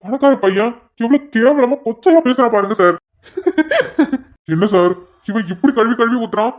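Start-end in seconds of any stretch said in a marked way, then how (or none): 3.4-4.2 short high burst of laughter; officer chuckling at the prisoner's foul mouth; amused laugh. Acted. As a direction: laugh at the rude outburst. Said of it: "நீங்க சிரிக்கிறீங்க?".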